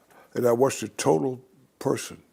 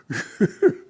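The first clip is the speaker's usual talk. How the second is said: quick amused laugh in the middle of the story; short laugh; 6 semitones higher, 4 dB louder than usual. Real, not acted. Laughs briefly while telling about his mother.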